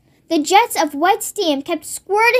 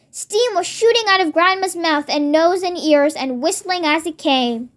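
ordinary delivery, nothing marked

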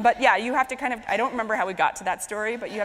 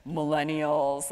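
usual speaking voice

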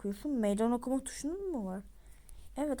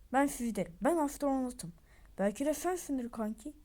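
usual speaking voice

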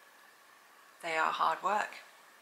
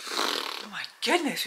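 ordinary delivery

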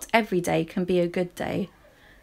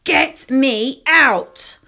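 put-on very slow, loud child's yell; fed-up toddler's drawn-out shout; demanding shout; 5 semitones higher, 7 dB louder, much slower than usual. A narrator's reading. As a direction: holler each word long and high. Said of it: "in the end I shouted".

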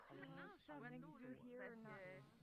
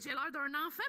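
normal talking voice

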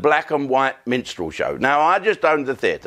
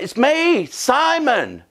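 much higher, lightly voiced old colleague; mock high voice; 11 semitones higher, much slower than usual. Old panto colleague Simon revealing himself to the teller, squeaking. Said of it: "He went".

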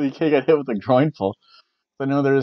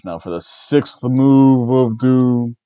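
louder, slow build to the sixth move; dramatic slow announcement; 5 dB louder, slower than usual. Acted. A fan hypes up Cena's finisher, slow and loud. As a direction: announce it like a ring caller.

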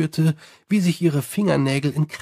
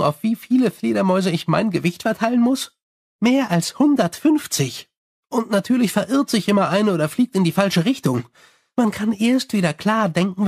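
higher, chatty vampire voice; Sally complaining about her bats, higher and chatty; 4 semitones higher than usual. Acted. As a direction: rattle on cheerfully like a gossiping vampire.

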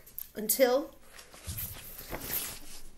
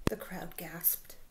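whisper; 7 semitones lower, 9 dB quieter than usual. A narrator's reading. Reader hushes the gasp.

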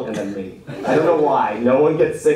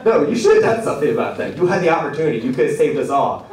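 raised-pitch comic reproach; mock indignation; 4 semitones higher, 3 dB louder than usual. Patient scolding the nurse who left, mock indignation. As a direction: protest as if let down, playing it for laughs.

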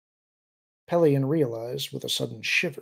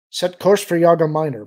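firm, louder command; raised voice, issuing an order to the bridge; giving an order; 4 semitones higher, 10 dB louder than usual. A narrator's reading. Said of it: "Pelion ordered".

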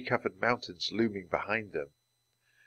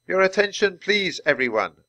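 a high voice calling out to a crowd for attention; 10 semitones higher, 5 dB louder than usual. A narrator's reading. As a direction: call out over the group. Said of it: "a woman in uniform called to the group".

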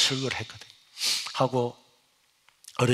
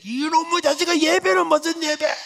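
mock his churchgoing with a shout; angry mother's retort; 13 semitones higher, 4 dB louder than usual. Mother snaps at son's reply. (비웃듯 소리치는 어머니)

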